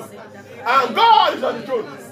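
stretched slow, still loud and high; slow loud declaration; slow drawn-out proclamation. Shown as a demonstration. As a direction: draw each word out, proclaiming. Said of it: "do you see how I was shouting?".

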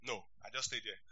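talking through a laugh; amused laugh. Real, not acted. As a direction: chuckle it out. Laughs at own story.

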